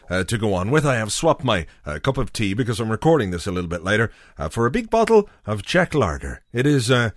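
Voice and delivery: ordinary talk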